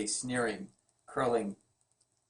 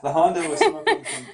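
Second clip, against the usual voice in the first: high, louder laughing read; 6 dB louder than usual. Real, not acted. Husband reading his wife's book, laughing high.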